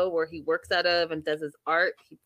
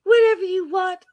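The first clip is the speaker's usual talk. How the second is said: high, loud, wounded mock voice; Debbie impression; 11 semitones higher, 6 dB louder than usual. Acting out a woman breaking up, high wounded voice. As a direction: throw it out high and hurt. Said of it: "She's like".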